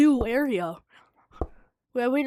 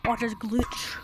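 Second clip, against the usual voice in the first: ordinary delivery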